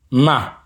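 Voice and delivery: Ma on a high level pitch. Shown as a demonstration. Teacher labels the high tone for learners: Ma high. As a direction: pitch Ma up high, same volume.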